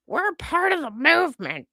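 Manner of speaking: high, mocking sneer at the self-important; mocking idiots. Mocking the idiots who claim the movement, high sneering voice. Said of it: "these idiots who are like".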